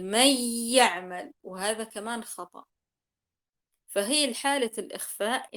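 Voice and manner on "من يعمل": "من يعمل" slow and loud, the nun merged into the ya; merged nun demo. Shown as a demonstration. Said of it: "مثل". (إدغام النون في "من يعمل")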